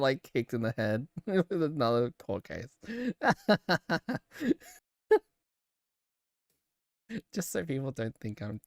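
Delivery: normal talking voice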